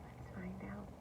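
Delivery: soft unvoiced whisper. Real, not acted. Teacher whispering to the children before the story goes on. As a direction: hush it like a secret. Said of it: "whispered to children".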